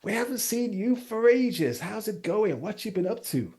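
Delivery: beaming, so glad to see you; excited reunion greeting. Acted. The couple catch up with old friends, high and delighted.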